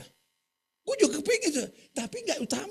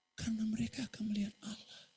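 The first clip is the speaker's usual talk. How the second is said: whisper; 8 dB quieter than usual. Acted. God's reply quoted.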